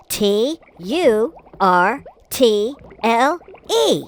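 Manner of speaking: ordinary talk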